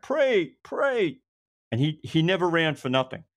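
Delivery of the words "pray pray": high-pitched imitation of a call back. Investigator summons the recruit back from the elevator, calling out. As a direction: call the name out high, twice.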